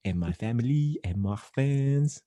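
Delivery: lovesick French woman; mock French accent. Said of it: "I've been practicing my French accent".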